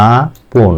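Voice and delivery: আপন slowly split as aa-pon; two syllables. Shown as a demonstration. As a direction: break আপন into two drawn-out beats, aa and pon. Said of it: "দুইবার". (আপন ভেঙে "আ-পন" দুইবারে)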